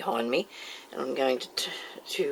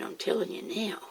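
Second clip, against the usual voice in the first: ordinary talk, nothing marked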